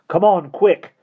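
high, loud urgent call to run; urgent shout. Acted. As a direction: yell at them to hurry, panicked. Fleeing the pursuing yacht.

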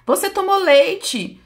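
high, loud, stretched-out scolding; quarrelling mother. Retold or imitated. Mother arguing over breastfeeding, loud high retold scolding. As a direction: imitate a nagging mother raising her voice. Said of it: "Eles ficavam brigando entre si".

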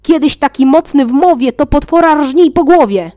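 loud, high retort; shouting back. Acted. Jasiek shouting back at Wicek, loud and mocking. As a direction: shout him down. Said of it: "Jasiek krzyknął nań".

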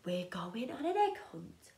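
hushed secret whisper. Acted. Whispered opening refrain.

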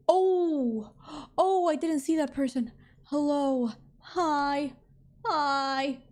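jump, then greet the unexpected stranger; startled greeting. Real, not acted. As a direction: caught off guard, pitch up. Startled by person in game.

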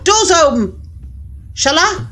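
ordinary talk

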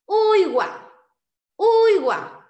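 UIGUA called out high and loud, falling at the end; model pronunciation. Shown as a demonstration. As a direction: say UIGUA clearly for learners, high first syllable held, then dropping. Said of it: "yo lo escucho así".

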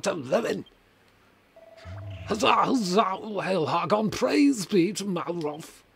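high, loud zealous cult preacher; evil preacher voice. Acted. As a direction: rouse the faithful to darkness.

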